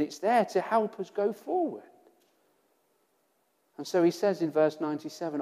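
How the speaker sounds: ordinary talk, nothing marked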